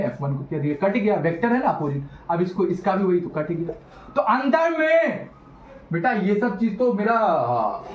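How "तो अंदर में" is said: high, slow, stretched-out cue; drawn-out prompt. Real, not acted. Teacher cueing students on the field inside, high drawn-out prompt. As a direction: call it out high and hold the last word. Calls out lead-in for students' answer.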